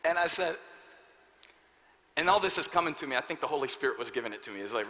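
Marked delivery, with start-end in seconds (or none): none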